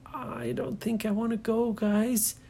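put-on reluctant girl not wanting to go; scared little girl. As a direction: shrink back, a nervous girl. Nora reluctant, voiced high.

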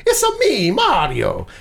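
a quick cartoon Italian catchphrase parody; mock Mario accent. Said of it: "made fun of in the stereotypical way".

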